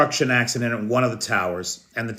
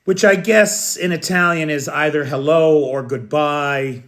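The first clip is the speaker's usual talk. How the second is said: normal talking voice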